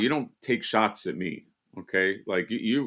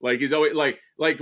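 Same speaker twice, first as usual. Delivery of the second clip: ordinary delivery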